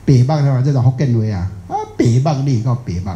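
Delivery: normal talking voice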